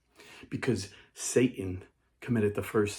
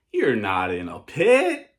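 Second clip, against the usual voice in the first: slow, drawn-out upbeat denial; Joel Osteen; 7 dB louder, slower than usual. Acted. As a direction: parody a televangelist, loud and stretched. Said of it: "Then Joel Osteen walks by and says".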